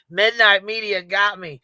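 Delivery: laughing through the words. Real, not acted. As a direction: chuckle as you say it. Laughs while saying it.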